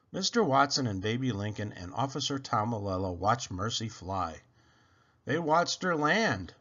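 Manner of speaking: ordinary delivery, nothing marked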